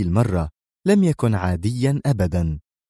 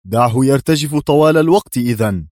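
louder, curt dismissal; firm, loud retort; 4 semitones higher, 6 dB louder than usual. A narrator's reading. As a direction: slap the objection down. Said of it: "في حزم". (رد حازم وأعلى صوتا)

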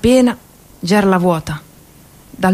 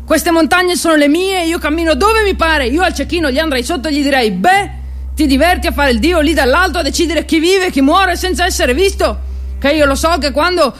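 indignant; 7 semitones higher than usual. Acted. Outburst against the sniper.

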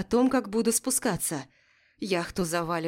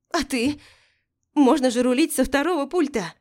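higher, louder pleading call; urgent shout; 5 semitones higher, 5 dB louder than usual. Acted. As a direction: push back over the roar. Arguing over the storm.

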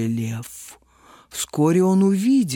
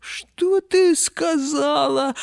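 amused disbelief; 9 semitones higher, slower than usual. A narrator's reading. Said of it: "расхохотался лев".

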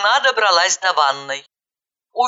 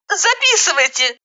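high-pitched urgent cry; 10 semitones higher than usual. A narrator's reading. Caller dictating her address to dispatcher, crying out. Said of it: "закричала я".

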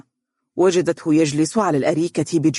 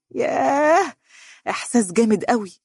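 dreamy exclamation in a raised pitch; thrilled; 7 semitones higher than usual. A narrator's reading. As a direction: swoon at the thought. Suitor imagining himself engaged.

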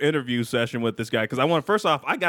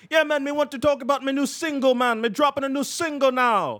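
higher mock Jamaican accent; 9 semitones higher than usual. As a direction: play a Jamaican rapper in a Jamaican accent. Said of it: "'Cause he's like".

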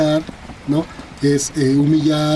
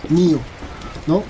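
normal talking voice

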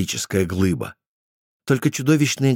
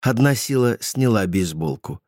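plain reading voice

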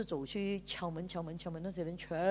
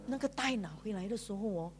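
ordinary talk, nothing marked

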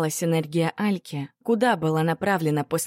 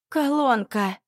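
hoarse, higher exclamation; hoarse cry; 7 semitones higher, slower than usual. Acted. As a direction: croak it out hoarsely. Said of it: "прохрипела рядом Машка".

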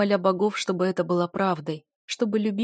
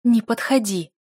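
loud, higher-pitched warning; raised-voice furious command; angry snarl; 4 semitones higher, 7 dB louder than usual. A narrator's reading. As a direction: order him back in anger. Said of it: "опять зарычала я".

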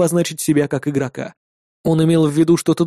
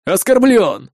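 higher, forceful shout; angry cry; 5 semitones higher, 3 dB louder, slower than usual. A narrator's reading. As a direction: shout it out as an accusation. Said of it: "крикнул Арви". (выкрикивает с обидой)

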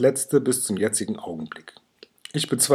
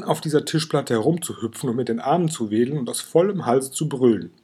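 ordinary delivery

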